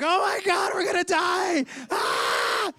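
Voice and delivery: loud, very high mock terror; panicked scream. Disciples crying out as the huge storm hits, hammed-up panicked scream. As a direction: shriek as if about to drown.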